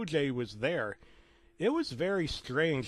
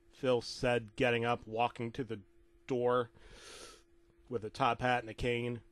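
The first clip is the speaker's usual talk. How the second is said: usual speaking voice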